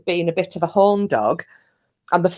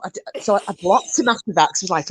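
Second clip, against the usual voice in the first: usual speaking voice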